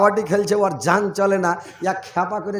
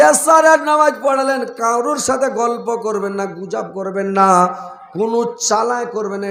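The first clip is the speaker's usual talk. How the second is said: impassioned preaching; 3 semitones higher, 6 dB louder than usual. Real, not acted. Preacher urging the congregation on night prayer, impassioned preaching.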